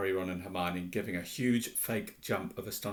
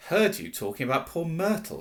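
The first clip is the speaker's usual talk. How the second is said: louder, higher, teasing poltergeist; mischievous Peeves; 5 semitones higher, 5 dB louder than usual. A narrator's reading. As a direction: tease with wicked delight. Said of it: "said Peeves, his eyes dancing".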